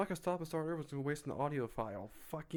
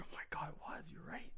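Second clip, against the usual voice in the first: whisper; 3 semitones higher, 8 dB quieter, faster than usual. Real, not acted. Caught mistake, hushed reaction.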